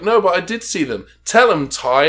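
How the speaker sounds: mock pleading for someone to back you up; insistent protest. Acting out Maddie's insistent protest.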